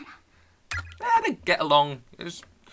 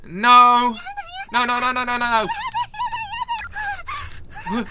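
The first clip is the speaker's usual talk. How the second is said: louder, higher pleading nos; panicked no; 4 dB louder than usual. Acted. Trapped girl pleading in terror, loud and high. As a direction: beg in rising panic.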